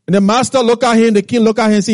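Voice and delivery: normal talking voice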